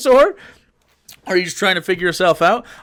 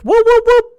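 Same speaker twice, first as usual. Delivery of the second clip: high whooping helicopter imitation, louder; mock chopper blades, pitched way up; helicopter whoop noise; 7 dB louder than usual. Mocking the helicopter joke with a loud high whoop.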